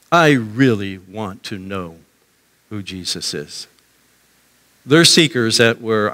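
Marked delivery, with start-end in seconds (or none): 0.1-3.7 low, slow, earnest confession; sincere seeker. Acted. Someone here today seeking Jesus, low and earnest. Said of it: "a person really there saying".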